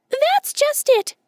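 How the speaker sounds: very high, squeaky and slightly drawn out; quick squirrel voice. A narrator's reading. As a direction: fire back like a nimble squirrel. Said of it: "he replied quickly".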